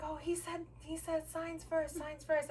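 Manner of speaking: high-pitched mimicry, not shouted; imitating his insistence. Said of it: "he's still screaming at me".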